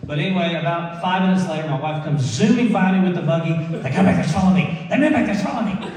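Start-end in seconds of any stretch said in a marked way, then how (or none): 3.5-5.9 high, loud alarmed cries; mock paranoid panic. Wife zooming past with the buggy, hammed-up paranoid alarm. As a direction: blurt it louder and higher, as if being chased.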